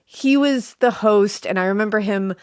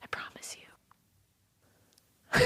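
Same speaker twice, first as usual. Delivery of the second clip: whispering; 16 dB quieter, much slower than usual. Acted. Playful whispered promise.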